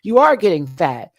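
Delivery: high, loud tearful mom outburst; cry-shout it like the scolding mother. Retold or imitated. Retelling the movie mother's speech to her daughter, crying loudly. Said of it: "the mom and daughter scene".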